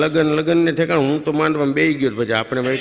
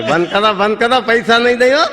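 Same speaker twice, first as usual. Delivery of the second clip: shouting higher and louder, building; mock angry shout; 7 semitones higher, 5 dB louder than usual. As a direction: yell at the band to stop. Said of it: "Dhiru હળી કાઢતો કે". (ઊંચા સાદે ગુસ્સાભરી બૂમ)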